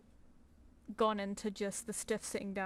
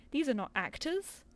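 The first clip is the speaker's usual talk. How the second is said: ordinary talk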